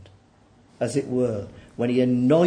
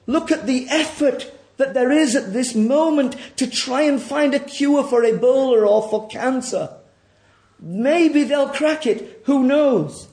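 raised, ringing sermon voice; forceful preaching; 11 semitones higher, 8 dB louder than usual. Acted. Preacher pressing the congregation to admit their sin, forceful. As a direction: press the hard truth on the congregation.

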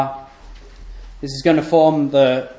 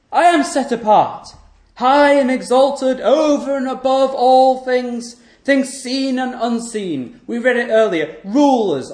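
raised, ringing pulpit voice; preaching at full force; 7 semitones higher than usual. Acted. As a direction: proclaim God's otherness to the congregation. Preacher at full force, God's words.

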